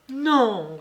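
high, loud desperate no; desperate loud refusal; cry out. A narrator's reading. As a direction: fling out a high pleading cry. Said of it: "s'écria-t-elle".

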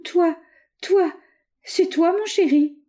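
high, tender little wail; plaintive. A narrator's reading. Lucette reviving finds her lover, plaintive. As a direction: break it into small, high, yearning bits. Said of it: "d'une voix plaintive".

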